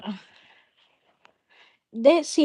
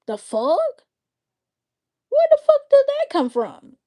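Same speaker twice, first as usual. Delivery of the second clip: much higher, louder incredulous cry; outraged disbelief; 3 dB louder than usual. Real, not acted. Outraged at being accused of saying it, high cry. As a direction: shriek it in disbelief.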